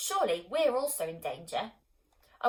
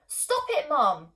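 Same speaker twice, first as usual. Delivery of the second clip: high, loud urgent plea; crying out; 6 semitones higher, 7 dB louder, much slower than usual. A narrator's reading. Girl crying out to stop her mum smashing plates. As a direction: shout to stop her at once. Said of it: "I cry".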